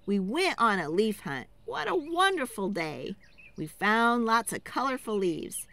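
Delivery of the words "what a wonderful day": usual speaking voice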